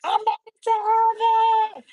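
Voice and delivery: high, far-reaching cry of joy; retold joyful scream. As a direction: shout it out like she finally arrived. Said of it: "when she started screaming".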